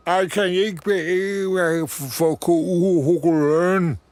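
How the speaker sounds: slow, high, loud mumble through a stiff mouth; mouth stuck in chocolate. Acted. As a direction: talk as if your lips are set hard. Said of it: "hun har svært ved at bevæge munden".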